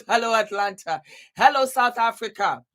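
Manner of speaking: ordinary delivery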